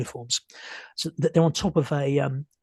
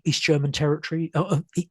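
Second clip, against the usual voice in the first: ordinary talk, nothing marked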